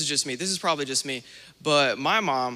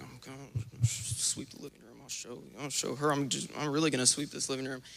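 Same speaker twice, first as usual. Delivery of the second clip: much softer, sullen mutter under the breath; teen muttering angrily while obeying mom, soft; sulky grumble; 9 dB quieter than usual. Retold or imitated. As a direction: grumble it to yourself, spiteful.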